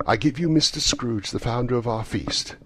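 proposing a toast. A narrator's reading. Bob proposes a toast to Scrooge.

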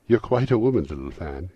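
high, loud start, settling down; boy's exclamation. A narrator's reading. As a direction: exclaim in a boy's admiring surprise. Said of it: "exclaimed the boy".

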